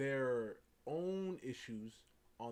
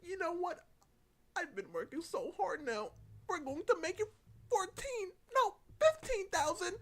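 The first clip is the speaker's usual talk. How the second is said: high voice building louder to the final figure; hammed-up haggler raising the stakes; mock escalating demand; 4 dB louder than usual. Artist hiking the price on Doki, high, building mock demand.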